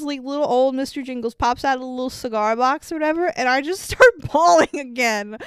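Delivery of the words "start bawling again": ordinary delivery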